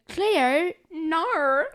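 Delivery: loud, high, exaggerated Australian vowels; drawn-out hammy Australian; mock Australian accent. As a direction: make fun of a TV show's Australian accent. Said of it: "the show they go".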